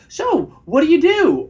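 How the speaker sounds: very high, loud, over-eager "what do you do"; nervous questioning. Shown as a demonstration. Coach shows the wrong way to ask her job, nervous high questioning. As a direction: pitch "So" way up, an eager interviewer. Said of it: "asking her what her job is".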